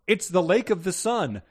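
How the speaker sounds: loud high reading of a shouted line; mock shout. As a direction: read it out like a shout. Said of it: "shouted the astronomer".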